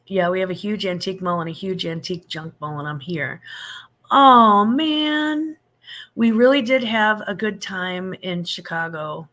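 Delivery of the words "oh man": high, loud startled oh man; a sudden loud "oh man"; surprised oh man. Real, not acted. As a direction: react as if caught off guard. Reacting to a comment.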